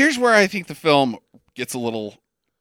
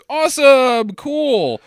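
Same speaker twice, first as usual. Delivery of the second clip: mock cheerful; 7 semitones higher, 5 dB louder, slower than usual. Family reacts to cure.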